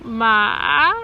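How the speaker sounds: ma dipping down then rising; third tone ma. Shown as a demonstration. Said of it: "so it goes down and then up higher".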